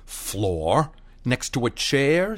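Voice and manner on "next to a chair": ordinary delivery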